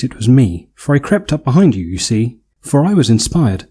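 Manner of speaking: ordinary talk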